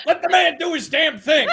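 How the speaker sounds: high, loud in-character shout; Buddy's yell. Acted. Player as Buddy cheering on a companion, yelling. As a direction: yell it out in support, half laughing. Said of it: "Buddy yells out".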